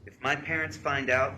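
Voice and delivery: quoted worry, punched out; emphatic text reading. Retold or imitated. Reading girlfriend's worried text aloud.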